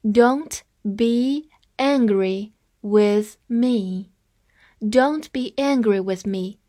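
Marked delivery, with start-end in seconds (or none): 0.0-4.1 slow, careful reading of each word; deliberately slow teacher read; read slowly. Shown as a demonstration. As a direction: spell it out slowly for learners. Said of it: "我再慢慢读一下".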